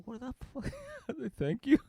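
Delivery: squeaky high disbelief that breaks into laughter; high-pitched "what the fuck" through laughter; a laughing, squeaky-voiced kid caught off guard; stunned, laughing. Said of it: "I was like".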